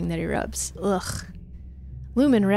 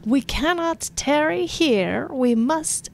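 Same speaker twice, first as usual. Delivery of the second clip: high, slow, overblown recital; pompous poem reading; 6 semitones higher, slower than usual. Acted. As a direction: declaim like a bad orator. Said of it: "reads from his hollow palm".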